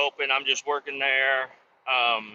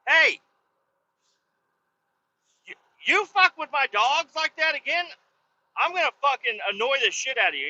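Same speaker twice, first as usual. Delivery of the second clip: high, heated warning; angry threat; 10 semitones higher than usual. Retold or imitated. Dog owner warning neighbor off his dogs, angry threat. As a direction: threaten him, re-enacting the anger.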